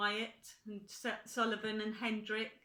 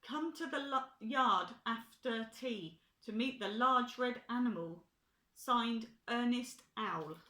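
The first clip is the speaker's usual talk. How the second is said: reading a notice aloud; 3 semitones higher, 6 dB louder than usual. A narrator's reading. Joe reads the notice aloud.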